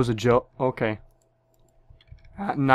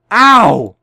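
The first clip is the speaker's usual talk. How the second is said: loud pained yelp; 6 semitones higher, 10 dB louder than usual. Real, not acted. Gets shot in game.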